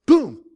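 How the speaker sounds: loud sound-effect boom; comic crash effect; mock boom. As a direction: punch it like an impact. Friend passing out in church.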